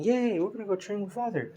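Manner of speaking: put-on high cheering kid voice; excited kids cheering. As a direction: cheer like happy little kids. Kids cheering about training.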